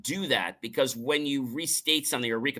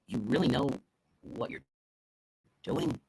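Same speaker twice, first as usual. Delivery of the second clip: soft, halting, doubtful delivery; no trust; 6 dB quieter than usual. Shown as a demonstration. Speaker showing little trust in the helper, hesitant doubt. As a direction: break it up with doubting pauses. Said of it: "little to no trust or respect in you".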